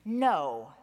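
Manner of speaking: sharp no. Acted. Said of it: "Peter said sharply".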